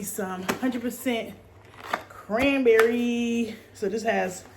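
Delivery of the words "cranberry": stretched word. Real, not acted. Drawn-out label reading.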